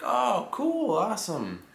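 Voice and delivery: excited reaction. Retold or imitated. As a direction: play up your delight at the news. Retold reaction to same city.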